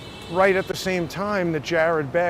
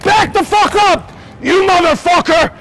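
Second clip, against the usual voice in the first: screaming much higher and louder in real rage; furious shout; 13 semitones higher, 11 dB louder than usual. Real, not acted. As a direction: yell him off the sidewalk at full force. Real street fight with cyclist.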